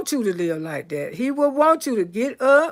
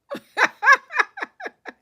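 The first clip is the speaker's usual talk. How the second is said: high burst of laughter; 13 semitones higher than usual. Real, not acted. Reacting to own punchline.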